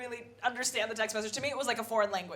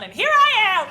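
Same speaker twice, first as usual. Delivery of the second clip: manic high exclamation; 11 semitones higher, 13 dB louder, faster than usual. Pretend. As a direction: shoot up high and loud in mock cheer. Imagined line to Sam's family.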